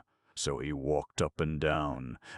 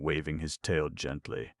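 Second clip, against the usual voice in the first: ordinary delivery, nothing marked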